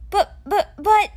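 stammered, high and flustered; sputtering. A narrator's reading. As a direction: trip over the words in shock. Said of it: "Alex sputtered".

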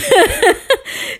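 sudden high-pitched laugh; short high cackle of real amusement; high burst of laughter. Real, not acted. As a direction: let out a squeaky laugh. Laughing at her own excuse.

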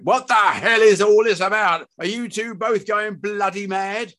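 high, loud outraged yell; raging father at full voice; furious shout. Acted. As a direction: explode at your family.

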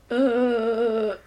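imitated drugged, wordless moan; drugged groan. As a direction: groan as if too drugged to speak. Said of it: "he can't really speak 'cause he's been drugged".

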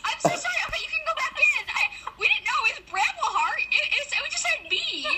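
high laughing apology. Real, not acted. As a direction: laugh through the sorry. Laughing apology after mix-up.

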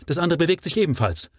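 high, fast, loud exclamation; thrilled at the answering light; excited. A narrator's reading. The Baron spots the reply signal, excited.

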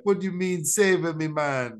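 a castaway brushing off rescuers in fake Jamaican; mock Jamaican accent. Castaway scoffing at his rescuers in a lightly put-on Jamaican accent. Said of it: "pick up an accent nobody knows".

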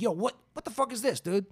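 high-pitched retold freaked-out protest; alarmed. Young man to tester hooking wires to his hands, alarmed. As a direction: imitate your own alarmed demand for answers.

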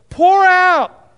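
high and loud cry of Pour; proclaimed cry. Shown as a demonstration. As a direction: call out Pour like a summons. Preacher proclaims corrected verse.

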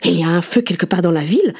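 usual speaking voice

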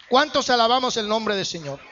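ordinary delivery

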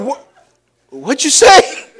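high shocked double take with a laugh; shocked what. Retold or imitated. Parents react to son at dinner in shocked disbelief. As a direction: blurt it out in disbelief, half laughing. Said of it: "and we turn and we".

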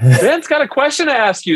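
high, fast and cheery; bubbly mother answering the door, quick; chirpy mom voice. Retold or imitated. Mother-in-law greets nervous suitor, bright and high.

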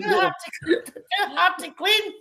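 laughing mock plea. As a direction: beg between giggles. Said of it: "I says".

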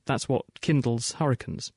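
normal talking voice

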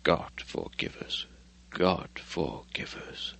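whispered, slow and shaken; horrified whisper. Acted. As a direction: breathe it out, appalled. Horrified men after the glimpse.

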